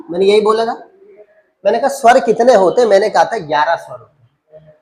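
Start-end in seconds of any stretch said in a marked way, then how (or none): none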